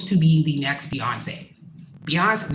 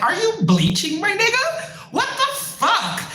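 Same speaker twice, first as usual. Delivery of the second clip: very high-pitched, appalled questioning; incredulous shock; 14 semitones higher than usual. Retold or imitated. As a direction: squeal it in disbelief, laugh creeping in. Acts out shock at ex's bleaching.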